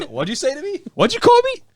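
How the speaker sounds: mock offense. Mock indignant reply to misheard order.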